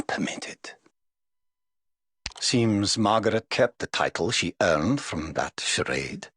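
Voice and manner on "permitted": whispered ending. Acted. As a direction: drop to a whisper on the last word. Sentence fades into a whisper.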